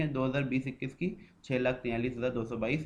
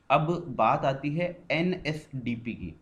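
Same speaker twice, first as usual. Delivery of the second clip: slow announcement; 2 semitones higher, 3 dB louder, much slower than usual. Acted. Introduces new topic slowly.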